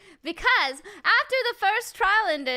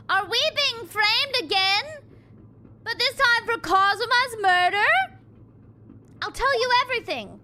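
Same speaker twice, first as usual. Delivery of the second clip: acted panic; 5 semitones higher than usual. Prisoner fearing a frame-up for murder, panicked. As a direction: blurt it out, pitched up in panic.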